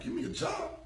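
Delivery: a high, offended double take; incredulous. Retold or imitated. Dave offers him a job, incredulous and high-pitched. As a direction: pitch shoots up in disbelief.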